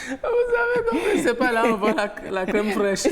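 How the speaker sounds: quoting through laughter; laughing. Retold or imitated. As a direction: laugh while repeating the joke. Repeating the sketch's punchline, laughing.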